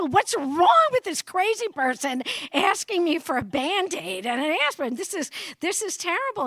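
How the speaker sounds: mock a man startled by a simple request; panicked man. Speaker mimics a man's panicked reaction, mock alarm.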